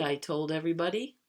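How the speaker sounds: usual speaking voice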